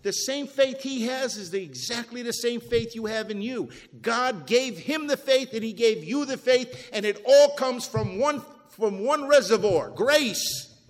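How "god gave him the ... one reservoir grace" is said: louder, rising preacher's cadence, hammering each phrase; full-force preaching. Acted. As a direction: preach it out to the whole church.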